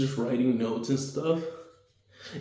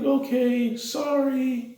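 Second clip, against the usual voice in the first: put-on very high, meek little child; small kid voice; 13 semitones higher, much slower than usual. Child apologizes to Deku, very high meek kid voice. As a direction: squeak out a timid apology.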